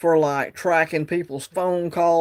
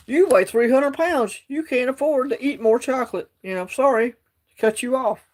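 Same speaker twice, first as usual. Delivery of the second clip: higher-pitched, lightly hammed-up official scolding; mock scolding official; 7 semitones higher than usual. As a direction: lightly mimic a prissy official refusing you. Mocking system denying chocolate.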